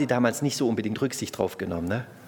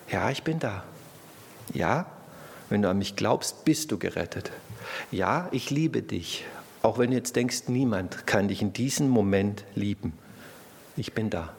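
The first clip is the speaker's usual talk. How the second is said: tender reassurance; 4 semitones lower, 5 dB quieter than usual. Acted. Preacher voicing God to the congregation, soft and reassuring. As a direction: speak it softly as a comforting voice. Voices God's answer.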